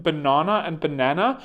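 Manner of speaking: "banana" as ba-NAH-na, then "banana" as ba-NAN-uh; contrasted banana vowels. Shown as a demonstration. Challenging Americans to differentiate, "banana" with AH, then with American A. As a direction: set broad AH "banana" against American flat-A "banana". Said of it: "You can't differentiate between".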